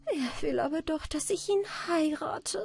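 put-on girl sighing to her grandmother. A narrator's reading. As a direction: sigh out your worry. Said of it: "seufzte die Anna Barbara".

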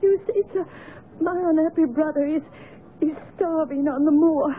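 sobbing, broken explanation; weeping confession. Acted. Servant confessing about her starving brother, weeping. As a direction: confess through tears, breath catching. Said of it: "the weeping unfortunate woman".

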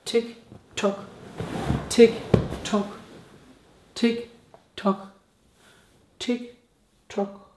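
soft, eerie tick-tock chant; creepy ticking whisper. Acted.

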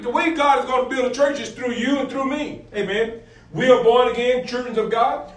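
ordinary delivery

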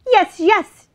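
high, loud, eager duck; quacking duck. A narrator's reading. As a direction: quack the answer excitedly. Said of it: "Duck quacked".

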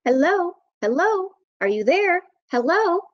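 high, eager phone hellos; calling out. Acted. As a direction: call hello down a bad line.

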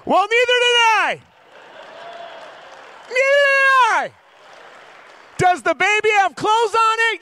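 very high, loud yelling; comic shouted outrage. Acted. Dad defending his baby-dressing to the men, comic shouted outrage. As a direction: yell it in hammed-up indignation.

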